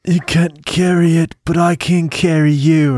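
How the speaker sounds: a hammed-up heroic Samwise; Sam impression. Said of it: "Much like Sam and Frodo".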